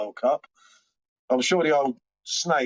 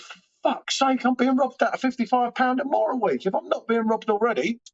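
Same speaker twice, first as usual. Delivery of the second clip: exasperated outburst; 7 semitones higher than usual. Retold or imitated. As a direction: blow up over the energy bill, dragging the words out. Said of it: "And then I thought".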